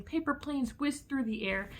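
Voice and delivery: ordinary delivery, nothing marked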